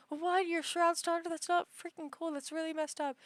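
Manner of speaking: a touch of high, hushed disbelief; imagined stunned reaction. Pretend. Viewers reacting to the daughter twist, mock astonishment. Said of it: "everyone's gonna be like".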